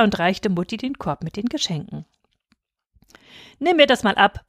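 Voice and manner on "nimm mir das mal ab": higher, bossy aunt; commanding. A narrator's reading. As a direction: order her about briskly. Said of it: "befahl sie". (befehlend, von oben herab)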